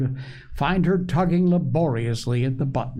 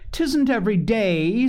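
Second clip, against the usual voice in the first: put-on little girl; 10 semitones higher, 3 dB louder than usual. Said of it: "said Phronsie slowly".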